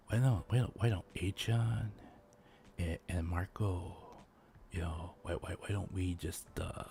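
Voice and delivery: sweet-talking the manager John in a low hush. Pretend. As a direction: whisper a smooth pitch to the boss. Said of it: "I sweet talk my way in".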